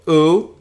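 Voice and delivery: u as a high "oo" with no h; u, clean "oo"; smooth breathing. Shown as a demonstration. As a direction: start u straight on the vowel. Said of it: "this is a smooth breathing".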